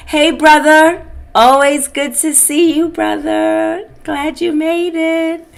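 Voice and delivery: high, bright call to a friend; cheerful hello across the room; warm greeting. Real, not acted. Greeting fellow patient warmly.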